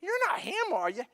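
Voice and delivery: high, curious neighbor questioning. Retold or imitated. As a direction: quiz him like a suspicious neighbor. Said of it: "they go".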